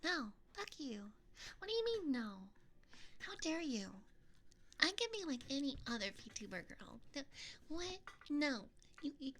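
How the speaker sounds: high, cutesy indignation; high VTuber-girl voice. Pretend. Streamer refuses chat in character, indignant high cute voice. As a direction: squeal protests in a girly voice. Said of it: "I'll stop doing this voice".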